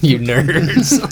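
laughing mock taunt. Real, not acted. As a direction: tease them through a laugh.

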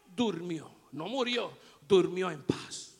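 soft calm. A narrator's reading. Peaceful death told softly.

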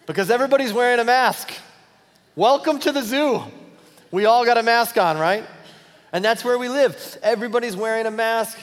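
loud, high preaching punchline; full-force preaching. Acted. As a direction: drive the point home to the congregation.

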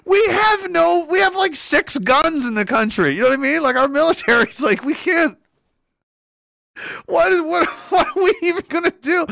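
high-pitched amused disbelief; incredulous. Real, not acted. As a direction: protest high at the sheer absurdity. Ridiculing the idea of military threat.

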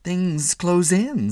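slow, drifting, distracted; said vaguely. A narrator's reading. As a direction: mind still far away, slow. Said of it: "said Walter Mitty vaguely".

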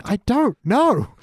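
high, slow, drawn-out plea; mock exasperation. Pressed about Sharif and the Casbah, mock exasperation. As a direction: stretch each word in playful despair. Exaggerated repeat of the answer.

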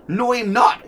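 high, loud interjection in the defendant's voice; mock-protesting interruption; defendant breaking in. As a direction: butt in as the indignant defendant.

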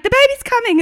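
high, loud mock cry; mock labouring mother. Mimicking a TV birth show mother, loud high mock cry. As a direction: ham up a woman quitting labour. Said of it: "And they're like".

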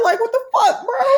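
high-pitched, half-laughing outburst; exasperated protest. Acted. Arguing why she would speak French, exasperated. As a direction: protest in comic disbelief.

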